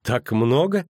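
high, rising sceptical question; mocking doubt. A narrator's reading. Girl doubting the man's cash, mocking. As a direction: tease with a lifted voice. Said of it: "Она насмешливо поморщилась".

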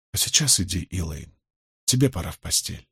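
low, soft coaxing; gentle, fatherly. A narrator's reading. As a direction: send a child off to bed softly. Том отправляет девушку спать. (ласково, вполголоса)

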